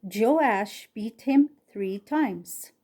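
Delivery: ordinary delivery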